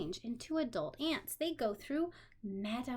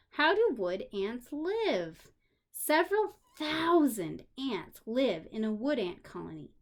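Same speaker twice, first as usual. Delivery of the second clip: put-on reading to children; 5 dB louder than usual. A narrator's reading. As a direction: clear storybook reading. Reading a children's book aloud.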